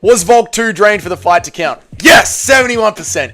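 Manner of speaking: loud triumphant yelling at chat; excited shouting. Real, not acted. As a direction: gloat loudly, thrilled to be right. Poll result celebration, shouting.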